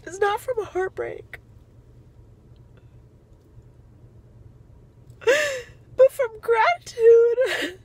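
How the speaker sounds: crying with gratitude. Real, not acted. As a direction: voice breaking into tears. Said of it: "I'm crying so much".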